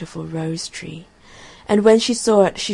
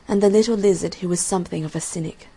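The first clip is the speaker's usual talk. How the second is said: ordinary delivery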